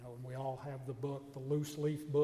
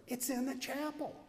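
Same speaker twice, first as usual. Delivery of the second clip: surprised announcement; 10 semitones higher than usual. Retold or imitated. Surprise news about the chapel.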